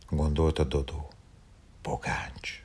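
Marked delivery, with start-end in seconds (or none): 1.8-2.6 soft whispered name to himself. A narrator's reading. Dodó whispering the dog's name to himself. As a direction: breathe the name under your breath. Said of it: "suttogta maga elé".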